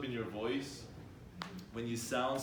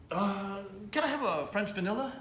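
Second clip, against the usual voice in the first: a hint of a hesitant, higher voice; unsure customer ordering; 9 semitones higher than usual. Shown as a demonstration. Coach mocks a timid order at Tim Hortons, mildly hesitant. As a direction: ask like you're not sure what you want. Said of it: "Why are you talking like that".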